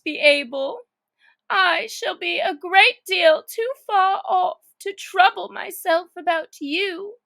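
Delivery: mock-tragic Alice, a little weepy; Alice pitying her feet. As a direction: mourn her far-off feet like lost pets.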